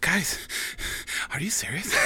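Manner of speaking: out-of-breath movie-star impression; pant, then force the words out; Mark Wahlberg. Comic doing his whole impression for the studio, breathless. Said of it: "out of breath Mark Wahlberg".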